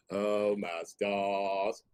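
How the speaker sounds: exaggerated belle sigh of shock; mock Southern belle. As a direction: drawl it like a fainting belle. Drawn-out belle exclamation.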